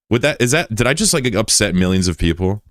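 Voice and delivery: normal talking voice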